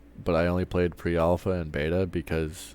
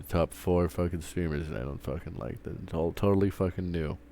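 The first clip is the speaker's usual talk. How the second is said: ordinary talk, nothing marked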